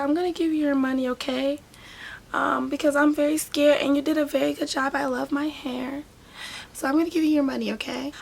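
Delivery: put-on high, sweet girlish voice; imitating the client. Client promising the braider payment, high sweet voice. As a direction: put on her high pleading tone. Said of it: "She's like".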